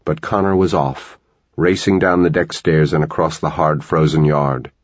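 ordinary delivery

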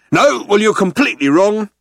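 high, loud alien captain; angry contradiction. A narrator's reading. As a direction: slap the idea down loudly. Vogon captain angrily contradicts Ford.

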